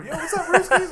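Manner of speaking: high, loud mock hail; playful greeting. Podcaster imagines hailing Russians by their nickname, playful greeting.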